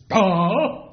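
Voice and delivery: loud, high gasped Oh; astonished oh. Shown as a demonstration. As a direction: show them what astonished sounds like. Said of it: "like that".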